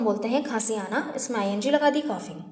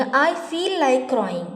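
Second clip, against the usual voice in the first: very slow, every word separated; slow model sentence; much slower than usual. Shown as a demonstration. Teacher models the phrase for learners, very slow. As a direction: lay it out word by word. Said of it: "बोलेंगे".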